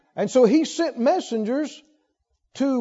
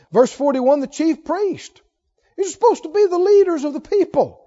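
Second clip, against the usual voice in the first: normal talking voice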